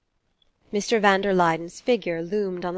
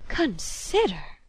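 drawn-out, higher echo of disbelief; indignant echo; 4 semitones higher, much slower than usual. Acted. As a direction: throw the word back slowly, offended. Mother indignantly echoes son's word.

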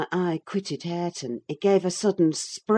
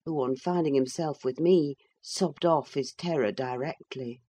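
ordinary delivery, nothing marked

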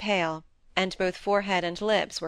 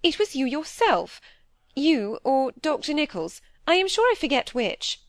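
high, louder flustered blame; driven to extremity; 8 semitones higher, 4 dB louder than usual. A narrator's reading. Cornered wife blames husband or doctor, driven to extremity. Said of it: "driven to extremity".

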